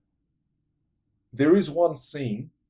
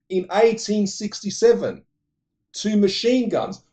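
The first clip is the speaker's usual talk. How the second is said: dramatic emphasis; 10 semitones higher, 8 dB louder than usual. Real, not acted.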